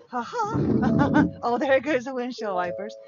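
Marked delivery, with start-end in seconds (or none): 0.1-1.2 real laugh. Real, not acted. Laughs at fumbling with truck.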